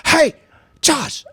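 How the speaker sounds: dad calling out his son's name, pitched high; high-pitched mock call; imitated dad. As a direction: mimic dad summoning his son, without shouting. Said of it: "He was like".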